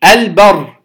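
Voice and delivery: البر with a clear vowelless ل before the ب; إظهار اللام. Shown as a demonstration. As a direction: sound the ل distinctly in "البر". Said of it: "أظهرنا اللام الساكنة". (البر بإظهار اللام الساكنة)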